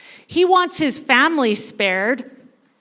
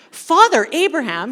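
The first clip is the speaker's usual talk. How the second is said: louder, eager call with a lift; excited appeal; 3 semitones higher, 6 dB louder than usual. Acted. Rich man gets an idea and calls Abraham, mildly excited. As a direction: brightly burst out with the idea. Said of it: "He's extremely excited".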